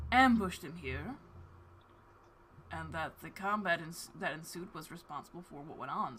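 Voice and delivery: soft, restrained figure of authority; reserved father. Acted. Father refusing to release his son, quietly reserved. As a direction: keep the ruling quiet and measured.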